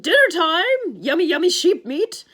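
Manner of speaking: high, very loud bellow; monster bellow. Acted. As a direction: roar the pet name across the cave. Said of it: "the monster bellowed".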